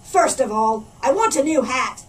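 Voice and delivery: loud pirate lament to his parrot; a booming pirate admitting defeat; pirate captain. A narrator's reading. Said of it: "said Backbeard".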